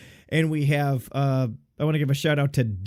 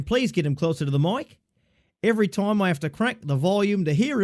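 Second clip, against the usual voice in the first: lightly put-on Australian accent, pitched higher; 5 semitones higher than usual. Host reads Australian fan Dan's note in a mock Australian accent. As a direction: read the fan's note like a cheeky Aussie. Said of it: "read it in an Australian accent".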